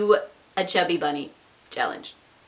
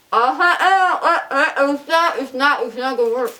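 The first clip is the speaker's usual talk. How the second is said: laughing with mouth full; 4 semitones higher than usual. Real, not acted. Laughing through mouthful of Cheetos.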